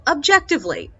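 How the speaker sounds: normal talking voice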